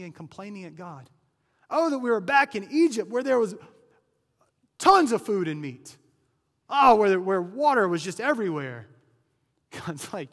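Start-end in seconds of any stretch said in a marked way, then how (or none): 1.7-8.9 loud, high mock whine, dragging the "Oh"s; preacher mocking the Israelites' complaints with a loud nasal whine; whining complainers. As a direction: ham up the grumbling Israelites, pining for Egypt. Israelites' grumbling voiced in sermon.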